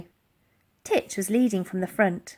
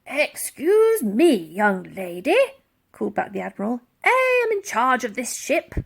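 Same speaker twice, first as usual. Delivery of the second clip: pompous admiral. Acted. The Admiral reminding Elsie he is in charge, pompous. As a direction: rebuke the girl with stiff dignity.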